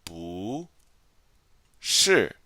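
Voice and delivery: 不 in a rising second tone before 是; 不 as bu rising, then 是 falling. Shown as a demonstration. Teacher showing students the tone change, 不 rising. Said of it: "notice how I'm pronouncing".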